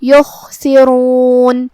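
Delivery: یخسرون chanted very slowly, kha and ra said full and heavy. Shown as a demonstration. Teacher shows the heavy letters to students, slow heavy یخسرون. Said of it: "را کو بھاری آواز میں پڑھیں گے".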